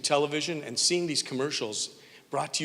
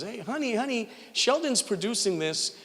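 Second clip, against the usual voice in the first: imitating wife calling; 8 semitones higher than usual.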